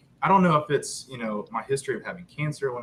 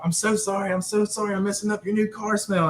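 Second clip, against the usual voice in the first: apologetic; 9 semitones higher than usual. Retold or imitated. Apologizing to wife in her car.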